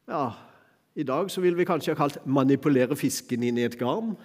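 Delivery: ordinary talk, nothing marked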